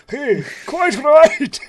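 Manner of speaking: slow, high, loud mock-posh exclamation; put-on posh accent. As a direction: ham up a pompous gentleman. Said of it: "he's trying to do an accent".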